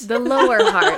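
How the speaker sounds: laughing. Real, not acted. Echoes joke, laughing.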